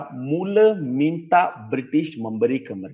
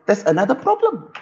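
mock British official, higher; British officer; 7 semitones higher than usual. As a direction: tell it like a smug colonial officer. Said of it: "British kata".

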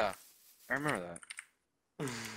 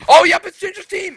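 panicked shout; 12 dB louder than usual. Real, not acted. Enemy team attacks.

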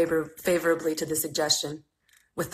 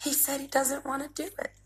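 much softer, higher embarrassed murmur; mumbled; 9 semitones higher, 9 dB quieter than usual. Acted. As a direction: mumble it, wishing to disappear. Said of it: "she mumbled".

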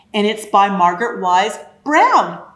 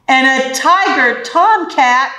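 usual speaking voice

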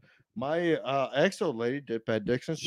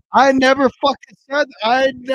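angry yell; 10 semitones higher, 9 dB louder than usual. Real, not acted. Heated denial, yelling.

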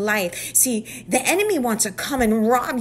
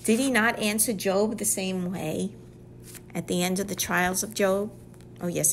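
ordinary delivery

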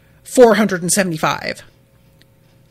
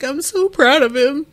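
higher, choked-up proud foster mom; teary Dadan; 6 semitones higher than usual. Retold or imitated. Dadan watching Luffy sail off, teary and proud. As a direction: gush through tears. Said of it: "gets all teary-eyed and is just like".

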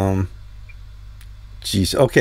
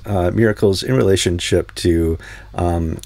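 usual speaking voice

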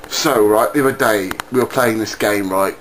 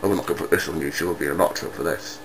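hurried aside; 9 semitones lower, 9 dB quieter, much faster than usual. Acted. Hurried aside after secret.

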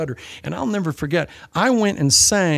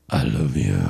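much lower, sneering "I love you."; mocking deep voice. Shown as a demonstration. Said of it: "you can say".

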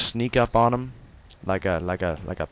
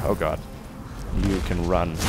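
usual speaking voice

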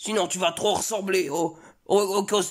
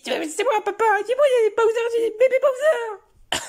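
put-on much higher and louder, eager kid; excited child; 5 dB louder than usual. Son telling his father about his Bowser babies, high excited squeal. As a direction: burst out proudly like a little boy.

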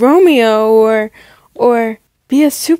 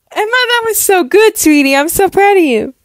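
high-pitched gushing praise; proud gushing mom; 8 semitones higher than usual. Acted. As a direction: coo proudly over your daughter. Mother praises daughter's reading.

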